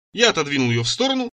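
ordinary delivery

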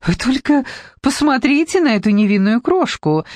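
high, twittering sugary voice; chirping sweetly. A narrator's reading. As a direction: chirp it like a sweet little bird. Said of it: "прощебетала она".

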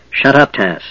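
plain reading voice

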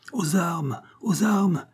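high-pitched call to arms; cried call. A narrator's reading. Fleeing crowd calling everyone to arms, high-pitched cry. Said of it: "criaient les fuyards".

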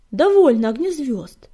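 firm, loud, decisive command; resolute. A narrator's reading. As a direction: put your foot down, loudly. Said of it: "решительно заявила она".

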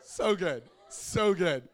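loud, overcome praise, half laughing; crying and laughing. Retold or imitated. Dad overcome by daughter's prayer, tearful laughing praise. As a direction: gush it as if laughing through tears. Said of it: "I am crying, and I'm laughing".

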